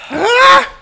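high, loud yelp of pain; real high-pitched "ah" of pain; pained cry. Real, not acted. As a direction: cry out from the burn. Hot sauce burning, cries out.

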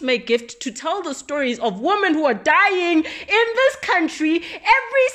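rising to a high, loud shout; building to a yell. Real, not acted. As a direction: end on a furious cry. Closing outraged rant.

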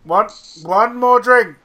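mock drunk insistence; drunken insisting. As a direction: insist loudly like a tipsy lad. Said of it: "I'm like".

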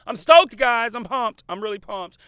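loud, high, overexcited surfer-dude voice. Pretend.

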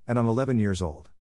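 usual speaking voice

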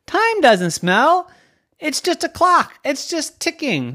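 put-on high-pitched child's protest; puzzled little girl. Little girl argues with her parents about her name, puzzled protest.

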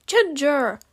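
loud rebuking yell of a name. A narrator's reading. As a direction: shout the name in reproach. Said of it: "Rayron yelled".

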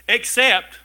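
except said high and loud; stress on except. Real, not acted. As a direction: hit the word except hard. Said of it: "stress on the exception".

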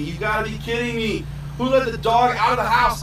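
acted-out outburst at the dog getting out; frustrated. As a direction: build to a loud, fed-up demand. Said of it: "I'm all frustrated".

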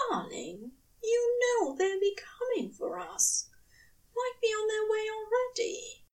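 a fussy angel warning his love; high, anxious angel pleading; worried angel. A narrator's reading. Aziraphale voiced in story.

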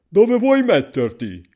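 high, loud, drawn-out query; stranger's loud question. A narrator's reading. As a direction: ask the tiny boy loudly and slowly. (domanda forte, acuta e lenta)

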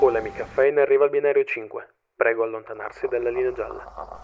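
fast station PA announcer; train station announcement. Acted. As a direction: call it like a platform speaker. Parodies platform announcement.

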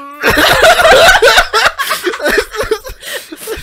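loud high burst of laughter; big laugh. Real, not acted. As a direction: crack up loudly. Loud laugh after the joke.

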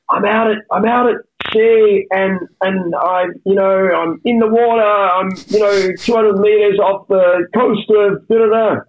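high, slow, strained caller voice; stranded caller at sea. Retold or imitated. Retelling an Apple ad, a swimmer calls for help in a high, slow voice. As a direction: pitch up and drag it out like a man calling from the water. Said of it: "this guy goes".

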